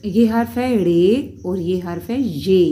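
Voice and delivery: letters रे and ये said slow and clear; careful slow naming. Shown as a demonstration. Teacher drilling letter names slowly: रे and ये. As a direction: spell out रे and ये deliberately. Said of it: "इसको योएं, जोएं ... कुछ भी नहीं पढ़ना".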